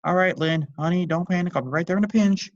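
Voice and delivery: high loud reassuring call. Acted. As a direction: call down the stairs. Mom calls from upstairs.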